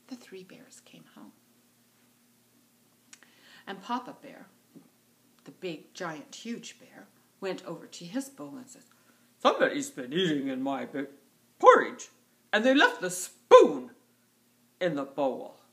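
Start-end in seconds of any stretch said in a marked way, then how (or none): 0.1-1.3 whispered, very soft; hushed narration. A narrator's reading. Narrator brings bears home as she sleeps, hushed. 9.4-15.6 big, loud bear bellow with swooping pitch; angry giant bear. Acted. Papa Bear finds his porridge eaten, angry bellow. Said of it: "Giant, huge bear did not like that".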